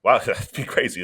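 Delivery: normal talking voice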